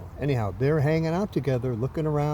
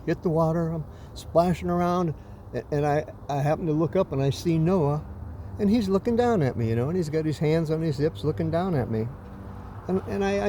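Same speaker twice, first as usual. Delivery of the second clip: usual speaking voice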